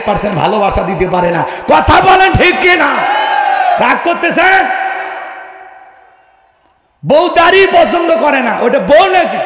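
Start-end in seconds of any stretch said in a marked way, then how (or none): 1.7-9.4 loud high challenge, slow and forceful; fiery shout. Real, not acted. Preacher shouting at the congregation about wives and beards. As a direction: thunder at the crowd for agreement. Preacher demands crowd agree.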